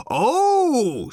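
loud, high, sustained mock-thrilled cry; shouted. A narrator's reading. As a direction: wail it out in sarcastic ecstasy. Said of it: "shouted Arthur again".

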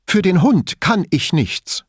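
high, slow, emphatic protest; defensive outburst. A narrator's reading. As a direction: burst out in your own defence, pitched well up. Elisabetta's sudden defensive outburst.

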